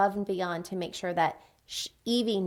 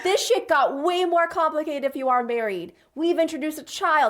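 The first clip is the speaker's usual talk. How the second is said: higher and louder exasperated rant; excited outburst; 6 semitones higher, 5 dB louder than usual. Retold or imitated. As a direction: blurt it out in mock disbelief. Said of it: "I was like".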